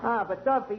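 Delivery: ordinary delivery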